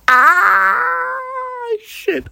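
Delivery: high, sudden frightened yelp; startled fright. Retold or imitated. Retelling the ghost's whisper, she yelps in fright.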